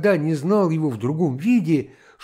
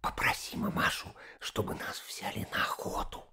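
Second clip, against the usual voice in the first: unvoiced secret whisper; 15 dB quieter than usual. A narrator's reading. Girl asking boy a favour behind the grown-ups' backs, whispering. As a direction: whisper a furtive request. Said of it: "сказала Катенька шёпотом".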